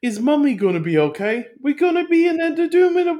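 high, childish mock voice; creepy little kid. Reviewer mocking how the movie's kid talks, high little-boy voice. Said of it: "exactly how that kid talks. He's like this".

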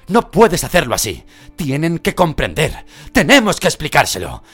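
high, loud, pressing plea; urgent pleading shout. Acted. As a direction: hold him back with your voice.

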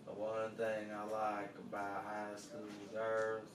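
low slow drawl, stretching age; lazy charming drawl; low, slow McConaughey drawl. Pretend. Doing the Wooderson line for a friend, low and slow. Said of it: "You could be like".

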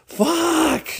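very high, squeaky outburst; exasperated fuck. Retold or imitated. Pranksters imagining her shocked reaction, squeaky high. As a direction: let it out in a squeal.

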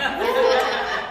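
a high, bright giggle at herself; embarrassed laugh. Real, not acted. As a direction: laugh off the awkward word. Embarrassed laugh after the word.

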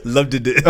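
LOVED said with a doubled -ed, laughing; mock the slip on "Loved", adding an extra syllable; mimicked slip. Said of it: "What did I say?".

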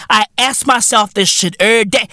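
ordinary talk

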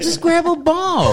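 slow, very high, loud squeal of advice; mock frantic owner. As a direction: shriek hammed-up advice over the chaos. Mock owner advice during attack.

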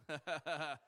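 short laugh to coax the crowd; prompting laugh. Pretend. Preacher after asking "get it", prompting laugh. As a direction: laugh at your own joke.

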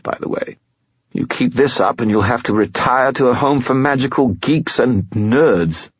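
high whiny spirit voice. A narrator's reading. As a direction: whine like a sulky skull. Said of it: "The skull's voice took on a whiny tone".

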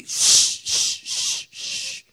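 whispered shushing, loud at first then fading; God's hushing shh. Retold or imitated. God hushing the frightened Israelites, whispered shh. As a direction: hush the frightened crowd like God.

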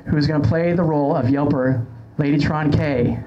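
normal talking voice

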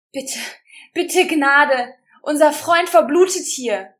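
loud, high desperate plea; pleading. A narrator's reading. Rosa begging the leader for mercy, loud desperate plea. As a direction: beg for mercy with everything. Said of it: "flehte Rosa".

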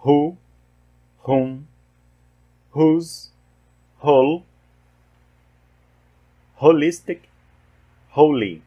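"Who", "whom", "whose", "whole", "holistic", "holy" with a breathy H and silent W; teacher modelling the aspirated H in "Who" and "holy"; English W H words said as H. Shown as a demonstration. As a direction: model each word slowly for students, W silent. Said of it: "são todas as palavras representando esse caso".

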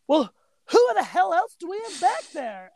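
lightly voiced dim deputy; a hint of a clueless deputy; mock deputy. Courtney voiced in retold scene.

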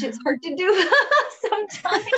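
laughing through the words, rising high. Real, not acted. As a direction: let the laugh break into the line. Laughing at own point.

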